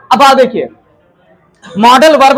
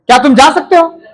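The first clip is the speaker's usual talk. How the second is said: sharper question; 4 semitones higher than usual. Shown as a demonstration. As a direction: press the question harder. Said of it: "थोड़ा सा तेज से बोलूं".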